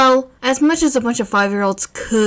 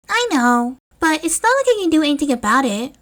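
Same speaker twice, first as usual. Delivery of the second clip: put-on young boy voice; 7 semitones higher than usual. Narrator voices young Izuku.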